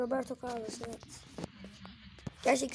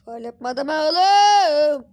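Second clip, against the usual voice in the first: drawn-out yell at the foul; slow, loud cry of protest; outraged shout; 3 semitones higher, 13 dB louder, much slower than usual. Real, not acted. Gamer yelling at the screen over a missed foul, slow and loud.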